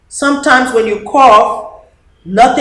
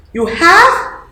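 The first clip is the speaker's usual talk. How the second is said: a mild surprised question, pitched high; surprised papa; 10 semitones higher, 5 dB louder than usual. Acted. Papa asking Dede, mildly surprised. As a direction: sound pleasantly surprised. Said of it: "Papa asked".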